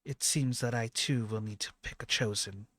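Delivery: lightly voiced goddess, pausing between phrases; formal and deliberate, with a strong opening; measured, trusting goddess. Game master plays goddess Anwa.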